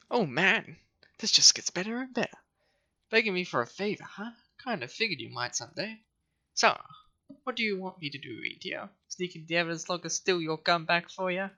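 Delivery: high, smug and snobby. Acted. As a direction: sneer every line like a snob. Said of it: "give him, like, a snobby voice".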